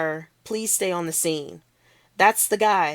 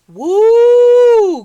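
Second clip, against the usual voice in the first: very high, loud cheering whoop; crowd whoop; 16 dB louder than usual. Acted.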